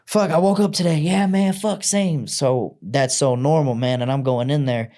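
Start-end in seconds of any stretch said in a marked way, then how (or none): 0.0-1.0 high, loud mock-weary griping; playful barracks banter, a touch over the top; joking army banter. Army banter joke, mock gloom. 1.0-2.3 high, loud mock-weary griping; playful barracks banter, a touch over the top; joking army banter. Buddy answers the banter.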